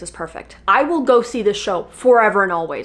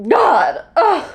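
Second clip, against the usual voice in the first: overcome; 11 semitones higher, 6 dB louder than usual. Real, not acted. Overwhelmed by emotional memory.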